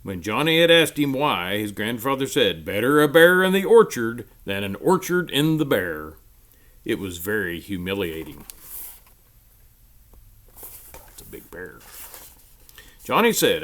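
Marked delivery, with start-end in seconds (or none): 2.6-6.1 a lightly voiced old grandfather; hint of a sly grandpa; grandfather's saying. Grandfather voiced in read story. 10.5-11.8 hushed aside to the listeners; slow breathy hush; whispered aside. Real, not acted.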